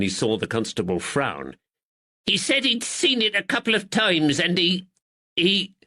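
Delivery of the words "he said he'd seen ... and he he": high, pleading northern shopkeeper; cornered man whining his innocence; frightened draper. Acted. Nervous draper pleads with the constable in a high voice.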